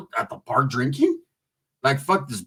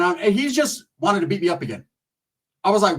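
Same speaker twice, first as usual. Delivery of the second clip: normal talking voice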